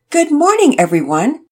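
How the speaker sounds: loud, high, bright-voiced call; ringing greeting. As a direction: ring it out to the room. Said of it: "Mister Hicks's voice rang out".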